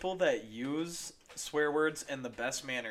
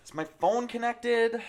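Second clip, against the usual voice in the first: high, loud, slow whine; loud whiny question; 10 semitones higher, 6 dB louder, slower than usual. Pretend. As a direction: complain to the whole house. Acted roommate.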